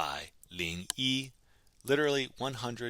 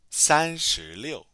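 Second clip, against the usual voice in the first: slow, loud and higher, drawn out; slow model pronunciation; 6 semitones higher, 10 dB louder, much slower than usual. Shown as a demonstration. Teacher giving the practice answer, slow loud model pronunciation. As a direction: project each syllable slowly for learners.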